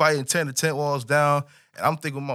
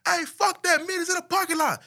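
screaming across the street. Retold or imitated. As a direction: yell it across the street, pitched high. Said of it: "He screaming across the street".

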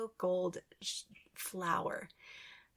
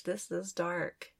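ordinary delivery

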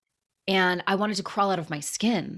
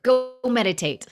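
healer's order; 6 semitones higher, 4 dB louder than usual. Retold or imitated. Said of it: "He said".

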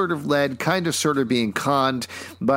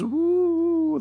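high hooting ghost wail; spooky rising ghost woo; ghostly woo. Acted. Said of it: "like a ghost".

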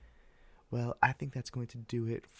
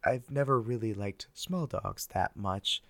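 normal talking voice

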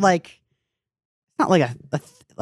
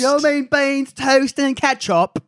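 higher, slow put-on English accent; mock English accent; 8 semitones higher, much slower than usual. Friend riffing on English diners, mock English accent. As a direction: join the bit as a baffled Englishman.

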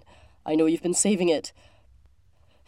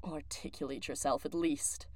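cold retort; 5 semitones lower, 10 dB quieter than usual. Acted.

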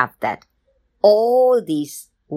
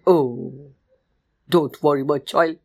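comforting mother; 2 semitones lower than usual. Acted. Mama bear comforts her stung cub, soothing. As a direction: gently reassure a hurt child.

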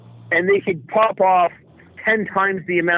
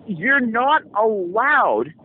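slow emphatic; 4 semitones higher, much slower than usual. Real, not acted. Stressing outrageous rule.

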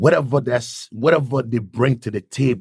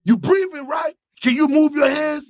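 commanding shout. Retold or imitated. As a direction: rouse him like a drill sergeant. God commanding him to rise.

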